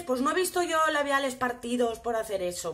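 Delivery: ordinary delivery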